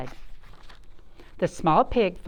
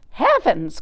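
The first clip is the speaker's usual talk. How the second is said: cried out; 5 semitones higher, 7 dB louder than usual. Acted. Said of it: "cried the farmer's wife".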